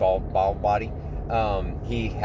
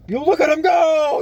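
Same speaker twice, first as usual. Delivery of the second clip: high, loud shout; whooping encouragement; excited cheer; 9 dB louder than usual. Real, not acted. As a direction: cheer him on at full voice. Cheering on someone at the table.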